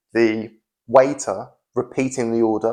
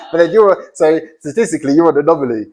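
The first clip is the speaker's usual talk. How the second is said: high, loud, laugh-tinged teasing; amused teacher tells a student they are an anomaly; still laughing; 6 semitones higher, 7 dB louder, faster than usual. Real, not acted.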